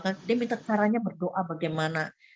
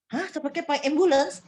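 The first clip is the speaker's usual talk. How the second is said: high-pitched incredulous question; mock-shocked echo; retold disbelief; 7 semitones higher than usual. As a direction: balk at the idea, pitch jumping up. Retold shocked reply to doctor.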